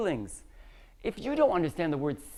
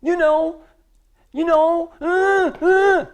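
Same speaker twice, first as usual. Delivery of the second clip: loud, higher-pitched caveman grunts; caveman grunting; 8 semitones higher, 9 dB louder, slower than usual. Shown as a demonstration. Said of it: "I sound like a caveman".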